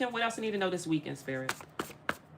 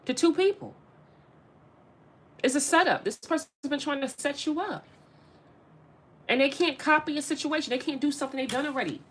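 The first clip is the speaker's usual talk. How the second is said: excited; 7 semitones higher, faster than usual. Real, not acted. Excited reading, pitch climbs.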